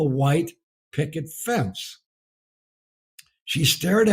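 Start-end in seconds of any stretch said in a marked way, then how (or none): 0.0-2.0 slowed for emphasis. Real, not acted. Slow dwelling on the fence.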